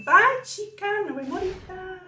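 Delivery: put-on playful high-pitched kid voice for the camera; cutesy high goodbye. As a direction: say bye like a tiny girl would. Mom voicing child's goodbye.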